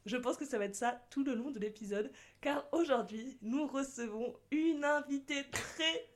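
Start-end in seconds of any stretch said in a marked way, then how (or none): none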